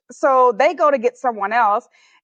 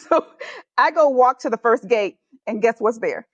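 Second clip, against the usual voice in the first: ordinary delivery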